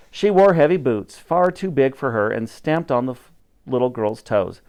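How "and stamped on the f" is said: plain reading voice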